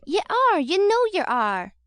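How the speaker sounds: high, loud, slow retort; whining retort. A narrator's reading. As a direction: whine it back at her. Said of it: "retorted Noah".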